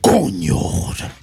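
low, hushed, whispered coño; mock intimate whisper. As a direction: breathe it to the camera. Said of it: "He's like".

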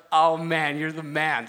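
loud, excited praise. Retold or imitated. To his friend after the sermon, loud excited praise. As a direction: cheer him on with delight. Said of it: "And I'm like".

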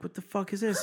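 high-pitched retold bewilderment; indignant disbelief. Driver retelling finding a ticket on the window, indignant disbelief. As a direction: re-enact baffled indignation, pitch up.

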